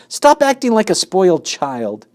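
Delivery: high, loud angry scolding; angry shout. A narrator's reading. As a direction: scold him furiously. Wilbur still screaming at rat.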